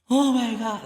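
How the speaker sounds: high, loud frightened exclamation; scared. Retold or imitated. Client watching the exorcism, scared outburst. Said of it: "I'm scared as shit".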